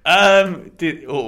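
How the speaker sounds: ordinary talk, nothing marked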